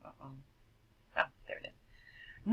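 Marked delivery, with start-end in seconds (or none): none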